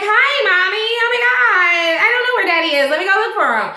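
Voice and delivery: put-on high little-girl voice; Paisley. Little girl answers her mother's call, high child voice.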